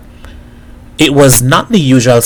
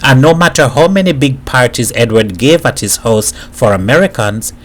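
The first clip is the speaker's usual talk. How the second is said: usual speaking voice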